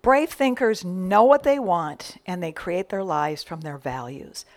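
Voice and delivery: ordinary talk, nothing marked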